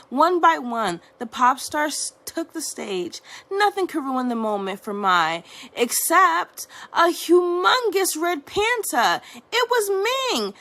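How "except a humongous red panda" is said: usual speaking voice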